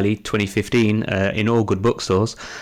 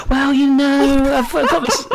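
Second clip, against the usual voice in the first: flustered reply; 4 dB louder than usual. Retold or imitated. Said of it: "I've stopped and sort of said".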